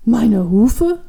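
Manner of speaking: loud, baffled question; dumbfounded. A narrator's reading. Old man asked to show hooves, dumbfounded. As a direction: echo it back in disbelief. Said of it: "fragt der alte Mann entgeistert".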